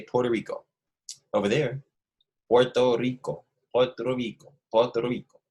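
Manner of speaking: "Puerto Rico" repeated with a Spanish accent and tapped R. Shown as a demonstration. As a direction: model the Spanish pronunciation of "Puerto Rico" for the class. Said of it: "how you say it with the accent".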